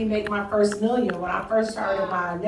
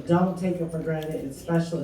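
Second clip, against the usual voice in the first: normal talking voice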